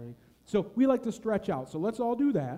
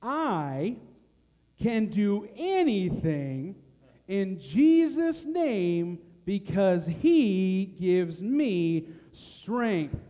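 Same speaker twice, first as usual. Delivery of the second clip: very slow and louder verse; nice and slow; 7 dB louder, much slower than usual. Shown as a demonstration. Leading the congregation slowly through the verse. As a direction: draw each phrase out for the congregation. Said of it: "say it nice and slow".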